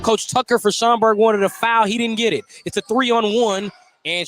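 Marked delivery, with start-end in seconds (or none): none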